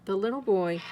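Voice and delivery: plain reading voice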